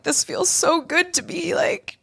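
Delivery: high dreamy trippy voice; blissed-out voice. Retold or imitated. Girl on shrooms enjoying a hug, high dreamy voice. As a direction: float it like you're high on shrooms. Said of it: "I'm like".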